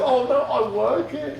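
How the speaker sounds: high, slow, silly protesting voice; high mock protest. Retelling meeting the artist, a high mock protest. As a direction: squeak out the excuse.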